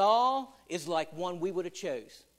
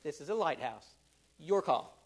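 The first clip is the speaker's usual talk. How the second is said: dry deadpan punchline; 5 semitones lower than usual. Retold or imitated. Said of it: "the Canadian says".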